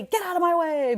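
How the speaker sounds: high, loud mock yell; snapping at the family to move; retold yell. Mom yelling at everyone to get out of her way, loud. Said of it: "yelling at myself, at my husband".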